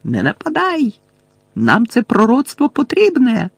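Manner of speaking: high, urgent cry; urgent high-pitched command; shouting. A narrator's reading. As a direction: call out to stop an attack. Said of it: "крики Мелфоя та Белатриси".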